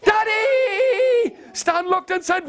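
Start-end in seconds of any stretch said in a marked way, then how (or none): 0.0-1.4 put-on high, long-held baby yell; shouted out. New baby calling to Stan, high drawn-out shout. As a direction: squeal it out, stretching the last vowel. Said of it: "It shouted out".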